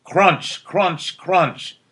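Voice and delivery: ordinary delivery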